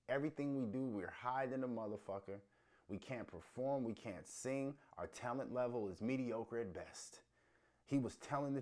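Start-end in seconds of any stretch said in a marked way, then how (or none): none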